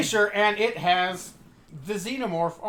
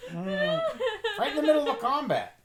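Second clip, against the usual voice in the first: laughing; 10 semitones higher than usual. Real, not acted. Laughing correction.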